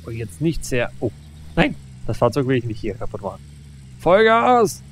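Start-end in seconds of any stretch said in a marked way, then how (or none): 4.0-4.8 high, loud battle cry; rallying shout. Real, not acted. Gamer urging his vehicle forward, loud rallying shout. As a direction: yell "Vollgas" as if charging forward. Ramming again.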